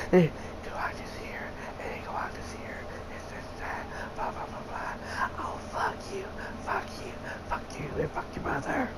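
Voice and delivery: mock whispered movie dialogue; breathy whisper, aping mumbled actors; imitating whispered movie audio. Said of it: "And half the times it goes like this here".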